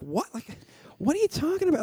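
stunned, what are you even saying; retold disbelief. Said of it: "And I was like".

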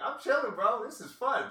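high-pitched party-guy quote; imitating carefree partier. Acts out man having great time.

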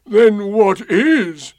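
loud, high, slow donkey voice; dismayed, drawn-out demand; Eeyore. A narrator's reading. Eeyore questioning Pooh, dismayed. Eeyore asking what is there.

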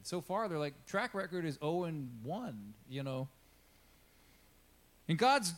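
ordinary delivery

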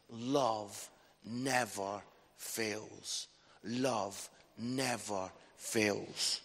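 very slow, soft and low, word by word; solemn scripture reading. A narrator's reading. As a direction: let each word land gently, drawn out. Closes Bible reading slowly.

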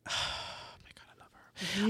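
breathless whisper of adoration; hushed, swooning whisper; awed whisper. Real, not acted. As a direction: whisper it, starstruck. Gushing about the drag queen.

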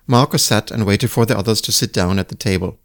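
ordinary delivery, nothing marked

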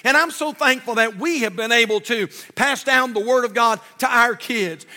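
usual speaking voice

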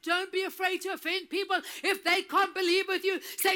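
ordinary delivery

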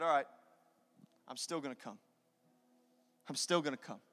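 low, soft acceptance; quietly resolve to go through with it; resigned Jesus. Acted. Jesus accepting the cross for his people, low and quiet. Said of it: "And he said".

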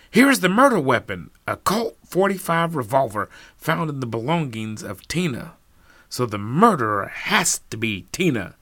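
loud accusing detective; cold probing inspector. Acted. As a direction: announce the find, triumphant. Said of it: "said the detective".

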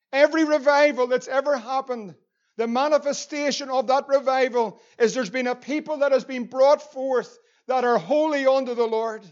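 ordinary talk, nothing marked

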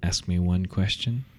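cold amused ghost. Acted. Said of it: "she smiles in cold amusement and says".

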